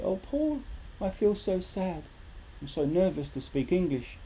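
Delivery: high whiny student voice; a hint of a sad student; mock sad student. Student complaining to teacher Paul.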